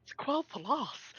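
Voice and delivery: drawn-out posh British impression. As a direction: imitate posh British with marbles in the mouth. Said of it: "trying to do the posh".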